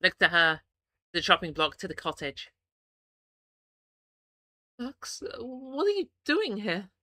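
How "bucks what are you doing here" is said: slow, high, trembling question. Acted. Iggy asking Bucks, trembling and high. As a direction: let the voice shake as you ask. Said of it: "My voice trembles".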